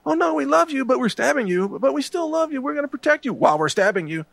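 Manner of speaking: sing-song the false comfort like a smiling traitor; mock sweet reassurance. The GOP mocked as lying while stabbing you in the back, in a high, syrupy fake-loving voice. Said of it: "and say".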